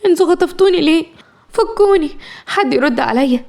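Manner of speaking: high, tearful pleading; breaking down crying. A narrator's reading. Kidnapped girl crying to her captors, tearful and high. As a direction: cry out in panic to be untied. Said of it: "وبتعيط من انهيار".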